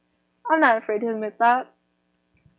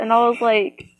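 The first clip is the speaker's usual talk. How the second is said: usual speaking voice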